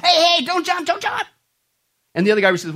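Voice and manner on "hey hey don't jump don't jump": high, urgent mock shout; high-pitched calling out in alarm; acted yell. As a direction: yell out to stop him, hammed-up. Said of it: "he yells to the guy".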